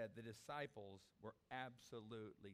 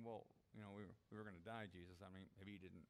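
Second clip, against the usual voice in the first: lower, much softer sheepish mumble; sheepish reply; 6 semitones lower, 7 dB quieter, faster than usual. Acted. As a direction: answer under your breath, embarrassed. Disciples answer Jesus sheepishly.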